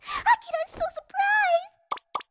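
slow, high, cutesy gasp; cutesy surprised pose. Shown as a demonstration. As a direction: ham up a cute surprised idol. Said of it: "demonstrates surprised pose".

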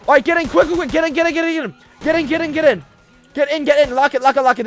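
high, urgent play-acted hurry; frantic urging. Player hurries officers into locked room, frantic urging. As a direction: rush them inside in mock panic.

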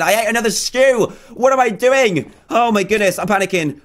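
high-pitched flustered exclamations; panicking. Real, not acted. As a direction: cry out, flustered by your own blunder.

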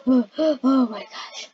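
panting, out of breath; gasping between words, out of breath. Pretend. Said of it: "I'm out of breath".

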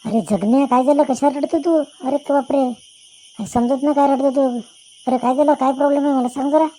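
a worried buddy calling out in a high, loud voice; concerned friend. Acted. Older friend finds Jaganya crying and asks why, high and loud.

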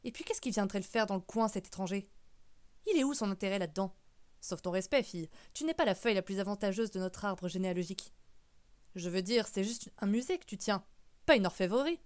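emphatic, incredulous questioning; exasperated uncle arguing with swooping pitch; scornful great-uncle. Acted. Great-uncle belittles match and niece.

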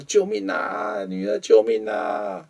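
high, slow pleading cries of help; a dragged-away mother begging her daughter, stretched out; mother crying for help. Retold or imitated. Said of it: "对着女儿说".

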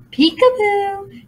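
high, slow sung peekaboo; singsong peekaboo. A narrator's reading. Demon peers at the girls, high singsong. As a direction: sing it like a creepy demon. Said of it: "she sang".

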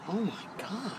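soft exasperated sigh of words; exasperated under breath. Retold or imitated. Reacting to the driver under her breath, exasperated. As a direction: barely say it, fed up. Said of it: "I was like".

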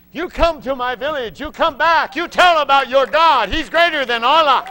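high, loud ringing call; chief's excited shout. Retold or imitated. Village chief's excited invitation retold.

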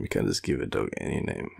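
ordinary talk

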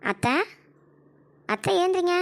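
high, pointed call to wake someone. Acted. Elder daughter-in-law tries to wake the old woman, high sharp call.

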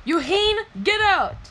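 high, loud exasperated command; angry order. Acted. Boy ordering the butler out, angry shouting. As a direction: shout the name, then the order.

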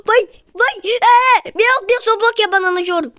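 high, loud gasping urging; gasp, then cry out high and fast; frantic excitement. Real, not acted. Gamer amazed at blocking a rival, high excited cries. Urging on during tense play.

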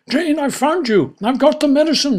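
high loud squawky parrot. Acted. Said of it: "says Admiral Parrot".

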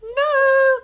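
very high loud squeak; high squeaky granny. A narrator's reading. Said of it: "called the woman".

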